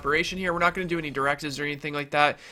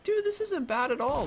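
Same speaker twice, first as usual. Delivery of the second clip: excited; 12 semitones higher than usual. Retold or imitated. Retelling his own happy reaction.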